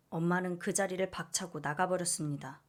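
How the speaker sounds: usual speaking voice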